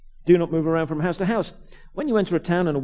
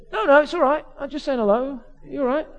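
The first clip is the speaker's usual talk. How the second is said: put-on high-pitched Cockney kid voice; reply as an unbothered street kid, pitched up; Cockney urchin; 9 semitones higher, 5 dB louder than usual. Cockney kid just saying hello to vicar, urchin voice. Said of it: "Real Cockney urchin type".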